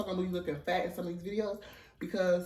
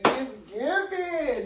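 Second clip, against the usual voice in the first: proud, sassy drawl; sassy drawn-out praise; 7 semitones higher, 4 dB louder, much slower than usual. Real, not acted. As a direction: stretch it slow and high with sass. Hyped self-praise in mirror.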